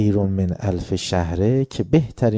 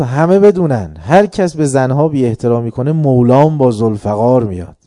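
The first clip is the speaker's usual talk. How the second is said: loud, higher call to the whole city; public proclamation; 3 semitones higher, 9 dB louder than usual. Retold or imitated. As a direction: proclaim it out across Kufa. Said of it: "عمار داد زد تو کوفه". (ندای بلند و رسا در کوفه)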